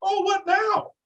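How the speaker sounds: high, sing-song mock sympathy; gloating taunt. Taunting cleaned-out flipper friends, high and gloating. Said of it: "I'm like".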